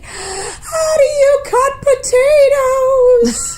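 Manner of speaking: high, loud, drawn-out wail; hammed-up slow sobbing cry; mock crying. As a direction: imitate someone howling the question through tears. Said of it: "she starts crying again".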